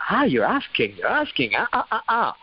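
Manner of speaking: sudden burst of exaggerated swooping cries; mock outburst. Shown as a demonstration. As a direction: burst out in a hammed-up singsong wail. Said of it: "give that little burst of".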